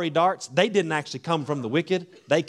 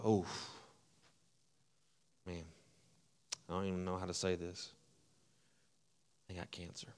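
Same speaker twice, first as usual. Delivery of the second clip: low sombre hush, like breaking terrible news; grave hushed tone; 11 semitones lower, 18 dB quieter, faster than usual. Retold or imitated. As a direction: drop to a grim near-whisper delivering bad news. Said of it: "it's a whole different tone of voice".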